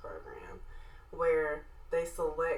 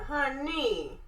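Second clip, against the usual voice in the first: sassy southern honey; 9 semitones higher, 3 dB louder than usual. Retold or imitated. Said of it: "And I said".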